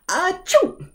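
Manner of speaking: high, sharp achoo sneeze; fake sneeze. Shown as a demonstration. Teacher imitating a sneeze for the class. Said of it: "the sound of sneezing".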